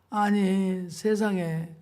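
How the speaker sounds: ordinary talk, nothing marked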